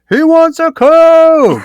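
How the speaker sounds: high, slow yell of the order; retold yell. Worker shouting the drink order across the restaurant, loud slow yell. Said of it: "He's yelling it".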